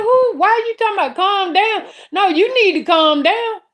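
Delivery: high and heated, rushing the words; worked-up high comeback; heated high retort. Retold or imitated. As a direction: fire it back, pitch way up. Heated retold argument.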